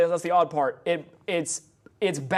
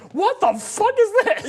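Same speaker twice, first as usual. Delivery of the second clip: much higher, slow incredulous squeal; baffled; 13 semitones higher, 6 dB louder, slower than usual. Retold or imitated. Reacting to a sudden beat switch, high slow disbelieving cry. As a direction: gasp it out in disbelief. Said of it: "and I'm like".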